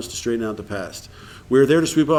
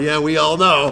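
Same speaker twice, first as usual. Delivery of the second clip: louder mock chorus with a laugh in it; knowing, through laughter; 2 semitones higher, 5 dB louder, slower than usual. Whole room exchanging looks about the relapse, laughing knowingly. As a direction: laugh out what everyone already knows.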